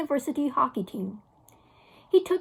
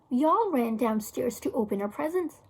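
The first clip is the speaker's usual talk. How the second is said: plain reading voice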